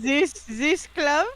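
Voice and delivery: put-on high, loud, drawn-out German accent; exaggerated German accent; squeaky German voice. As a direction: say it slowly as a cartoon German. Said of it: "squeaky German voice".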